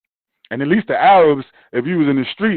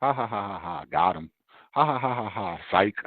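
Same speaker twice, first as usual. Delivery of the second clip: low, quiet spoken taunt of ha ha ha; mocking made-you-look laughter under the breath; 10 semitones lower, 10 dB quieter than usual. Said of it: "they sit back and laugh".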